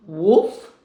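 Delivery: loud barking woof; dog woof. Acted. Grandma barks woof for her grandson. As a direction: bark it like a playful dog.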